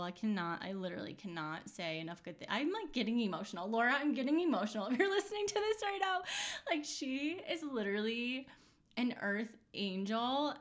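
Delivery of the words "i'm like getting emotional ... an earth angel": fast, higher, laughing gush, choked up with real feeling; overflowing with love, laughing through it; getting emotional. Real, not acted. Thanking her coach Laura, choked up and laughing. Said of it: "oh my God, I'm seriously so emotional".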